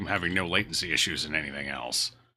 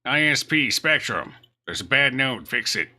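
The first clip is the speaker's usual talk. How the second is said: demanding customer call; 7 semitones higher, 7 dB louder, slower than usual. Retold or imitated. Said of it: "to be like".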